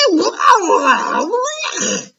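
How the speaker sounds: high shrill roaring cry; giant roar of disgust. Acted. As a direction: roar in revulsion. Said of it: "roared the Blood Bottler".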